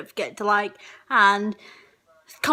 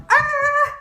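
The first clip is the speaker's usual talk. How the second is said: very high loud screech; a shrill bird shriek; high cartoon animal squawk; 6 dB louder than usual. Shown as a demonstration. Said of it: "animal impressions".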